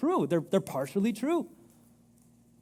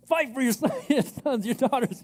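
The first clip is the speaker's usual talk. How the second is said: high, laughing mock battle cry; 5 semitones higher than usual. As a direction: laugh through a playful rally. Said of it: "your husband to take out the trash".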